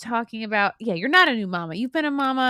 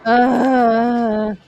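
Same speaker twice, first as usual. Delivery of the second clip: loud drawn-out groan of discomfort; heavy, sustained 'ugh'; long groan; 2 semitones higher, 8 dB louder than usual. Acted. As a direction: groan it out as if queasy from the ride. Groan after the ride.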